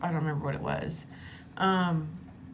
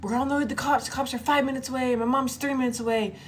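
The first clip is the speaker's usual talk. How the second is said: high, projected warning shout; yelling out; 7 semitones higher, 3 dB louder, faster than usual. Retold or imitated. Fleeing girl warns stalker cops are coming, retold yelling. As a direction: call it out to scare off a pursuer. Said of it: "I start yelling out".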